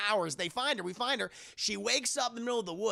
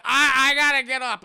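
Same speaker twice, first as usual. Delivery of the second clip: panicked; 6 semitones higher, 12 dB louder than usual. Retold or imitated. Drugged Danny panicking.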